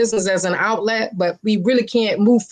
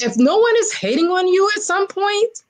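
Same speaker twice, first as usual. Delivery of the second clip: emphatic mentor; 10 semitones higher than usual. Retold or imitated. Mentor's words, said higher.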